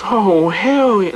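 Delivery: long, swooping flippant reply; flippant judge. Retold or imitated. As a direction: imitate a judge relishing it, stretching the words. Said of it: "kinda flippant".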